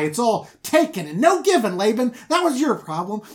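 high, loud impassioned rant; ranting Jacob. Retold or imitated. Jacob ranting at Laban about his hard work, loud. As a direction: build the grievance to a shout.

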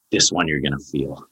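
ordinary talk

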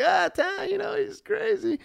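high, light, falsely cheerful voice; forced smile. Retold or imitated. Excusing crazy friend to passers-by, forced smile. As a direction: smile through it to cover embarrassment. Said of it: "I'm smiling at people crossing the other way".

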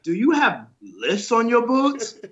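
high, slow mock-incredulous question; teasing friend. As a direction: draw it out like a teasing coworker. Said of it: "he's like".

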